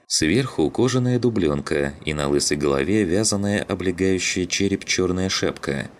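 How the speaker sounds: ordinary delivery, nothing marked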